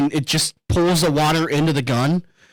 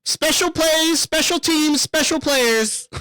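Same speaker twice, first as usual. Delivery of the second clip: much higher, drawn-out mock announcer chant; imitating streamer catchphrase; 11 semitones higher, slower than usual. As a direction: ham up the streamer's bit, slowing at the end. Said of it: "that's his thing".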